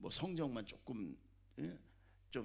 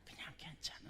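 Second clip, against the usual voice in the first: much softer whispered reassurance; 2 semitones higher, 12 dB quieter than usual. Real, not acted. 중간 이하 아이들 마음을 대신하며 속삭임. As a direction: breathe it out, easy and calm.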